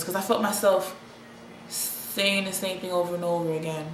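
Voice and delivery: usual speaking voice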